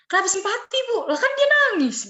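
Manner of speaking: high, loud challenge to a teacher; acted-out student objection; indignant student protest. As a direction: argue back in disbelief, pitch leaping. Retold argument with the teacher.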